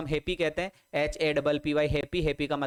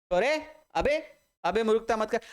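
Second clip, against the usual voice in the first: mock scolding; 4 semitones higher than usual. Mock scolding to recall word.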